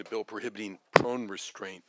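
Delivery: ordinary talk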